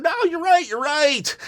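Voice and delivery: squeaky fake surrender; mock meek agreement. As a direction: pitch it way up like a man caving in. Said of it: "but then he said".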